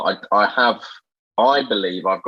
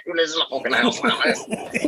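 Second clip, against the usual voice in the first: hurried, higher-pitched mock presenter voice; Lloyd Grossman; 7 semitones higher, 4 dB quieter, faster than usual. Friends mock the impression's accent, laughing presenter send-up. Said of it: "it's Lloyd Grossman here".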